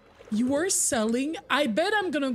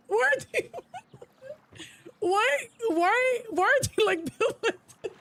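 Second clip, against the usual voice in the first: high, disbelieving why, why; baffled high-pitched whine; incredulous squeal; 9 semitones higher than usual. Real, not acted. Incredulous at figure's shape.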